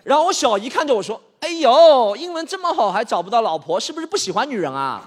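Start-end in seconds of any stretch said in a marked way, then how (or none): 1.4-5.1 loud, high, nosy auntie; teasing aunt. Acted. Aunt needling her unmarried nephew, loud and high. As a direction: tease him mercilessly.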